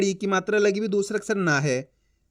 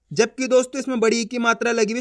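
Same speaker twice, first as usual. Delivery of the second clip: normal talking voice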